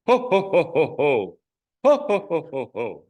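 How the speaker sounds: high, loud jolly ho ho ho; big belly-laugh ho ho ho; Santa Claus laugh. Shown as a demonstration. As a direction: boom it out like Santa handing out gifts. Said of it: "Be Santa Claus".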